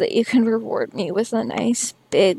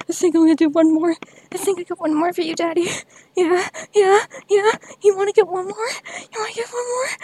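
breathless excitement; 6 semitones higher, faster than usual. Real, not acted. Building again, fast high.

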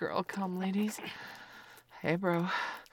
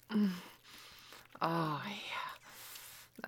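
aroused whisper; 6 dB quieter, much slower than usual. Acted.

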